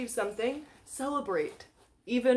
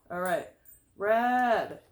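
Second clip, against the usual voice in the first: ordinary talk, nothing marked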